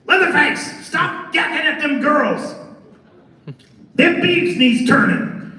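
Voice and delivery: put-on high-pitched, drawn-out old man's yell. Old man scolding Leatherface for chatting with girls, yelling. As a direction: holler it high and slow, like a cranky old man. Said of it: "the old man is yelling at Leatherface".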